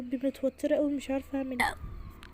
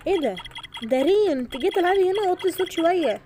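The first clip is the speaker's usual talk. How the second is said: loud, high telling her to keep it down; scolding; 7 semitones higher, 8 dB louder than usual. Acted. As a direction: shout at the little sister. Scolding sister to lower noise. (زعيق على الأخت الصغيرة)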